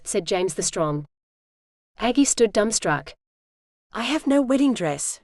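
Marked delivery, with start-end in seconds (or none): none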